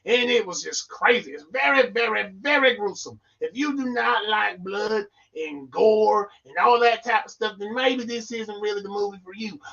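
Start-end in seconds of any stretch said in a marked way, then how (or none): none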